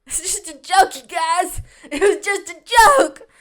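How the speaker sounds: high plea building to a loud cry; mock protest. Mock protest to the offended classmates.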